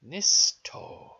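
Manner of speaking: "Nisto" loud, split into two long syllables; "Nisto" with a held s and long final o; careful sounding-out. Shown as a demonstration. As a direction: sound out "Nisto" slowly for learners. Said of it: "phonetically sound out all these numbers".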